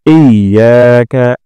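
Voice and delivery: "ইয়াকা" with ইয়া held long; very slow, stretch the ইয়া; lengthened ya. Shown as a demonstration. Teacher showing the long ইয়া. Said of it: "ইয়াতে টান দিব".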